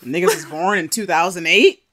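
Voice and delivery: loud disbelieving question, rising at the end; can't-believe-it outburst; incredulous. Real, not acted. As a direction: blurt it out in amused shock. Shocked reaction to the birth year.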